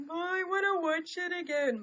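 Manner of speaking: high, loud eager gush; excited gushing. Retold or imitated. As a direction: gush like a fan who can't wait. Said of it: "I will get all like".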